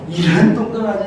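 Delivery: a light loving scold to his son; fond mock curse; doting scold. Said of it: "이러면서". (아들에게 하는 다정한 꾸중)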